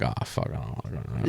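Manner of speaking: very low, hushed muttering; barely voiced, reluctant grumble; muttered dread. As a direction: mumble it under your breath. Retold dread as stranger approaches.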